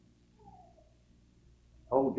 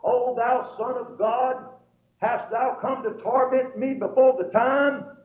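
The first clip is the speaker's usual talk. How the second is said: high, drawn-out cry of the possessed man; retold yell; 10 semitones higher, 4 dB louder, slower than usual. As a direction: yell it out slowly and high, preacher-style. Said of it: "he yelled out".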